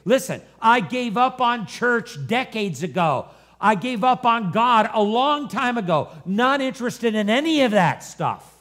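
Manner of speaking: acted-out high, loud rebuff; heated, emphatic dismissal; exasperated refusal. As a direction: lay down the law as a fed-up grandma. Grandma's angry refusal.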